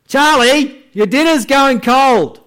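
high, loud annoyed parent; calling kids to dinner. Retold or imitated. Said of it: "So you call your children for dinner".

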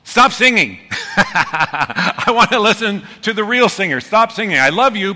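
high, loud mock plea with a laugh; exasperated plea. To the person singing along in the car, loud laughing plea. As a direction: beg them to stop, half laughing. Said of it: "turn to the person and go".

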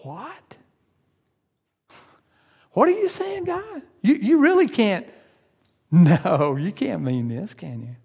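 hammed-up alarmed protest; mock shocked fear. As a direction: panic at God's request, half laughing. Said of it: "Maybe we're afraid".

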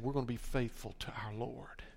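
hushed whisper of a vow; whispered pledge. Retold or imitated. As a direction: breathe it like a quiet promise. Young people's vow in a sermon.

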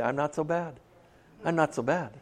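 soft, offhand self-justification; mock self-excusing. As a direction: mimic a quiet excuse, playing it down. Voicing people's excuses to themselves.

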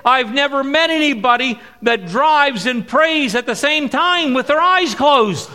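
retelling the deacon's astonishment; amazed quote. Retold or imitated. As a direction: marvel at it out loud. Deacon voiced in retold story.

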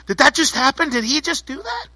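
high-pitched, incredulous questions; acted astonishment, pitch climbing high; stunned disbelief. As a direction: turn to a buddy in amazement. Stunned witness turns to buddy.

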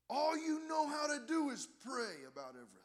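high, loud imitation of a furious heckler; irate holler. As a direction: holler it like the irate man, easing off at the end. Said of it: "irate and began to holler".

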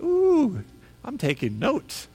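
a high, thrilled ooh, then dropping to a hush; eager delight. Retold or imitated. Pastor recalling hearing the sermon text, a thrilled ooh. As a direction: squeal with delight, then settle in to take notes.

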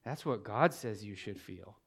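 low, soft retold reply; quiet firm correction. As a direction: answer the outburst quietly, pitched well down. His firm answer to her outburst.

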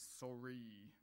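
put-on grudging kid's apology with a long-drawn final E; angry sorry. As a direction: drag out the "ee" ending of "Sorry". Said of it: "the extra E on the end of sorry seems to be important".

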